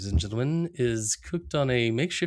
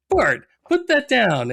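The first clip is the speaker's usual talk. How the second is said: much higher, slow scolding mom voice; Marge scolding; 11 semitones higher, 5 dB louder, slower than usual. Retold or imitated. Cohost voices Marge warning Bart, high slow scold. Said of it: "Mart's like".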